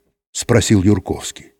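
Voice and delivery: usual speaking voice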